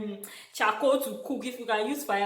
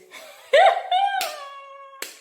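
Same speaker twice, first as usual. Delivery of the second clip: loud high laugh; 9 dB louder than usual. Real, not acted. Laughing at herself.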